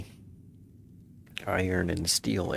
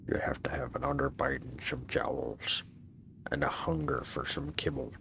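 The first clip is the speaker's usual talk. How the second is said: gruff bulldog voice; 4 semitones lower, 4 dB quieter than usual. Pretend. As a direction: growl it like a jowly bulldog. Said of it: "I have to work on my bulldog voice".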